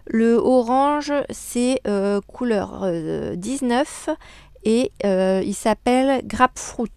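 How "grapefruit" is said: English word in a thick French accent; French-accented English pronunciation; bad English accent. Real, not acted. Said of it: "désolée pour mon super, euh, accent anglais".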